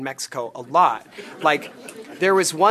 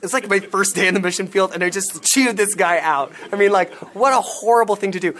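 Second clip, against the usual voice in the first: ordinary talk, nothing marked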